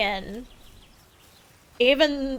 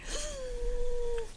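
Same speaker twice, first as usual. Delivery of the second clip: high laugh; 11 semitones higher, 9 dB quieter than usual. Real, not acted. Laughing at own sarcasm.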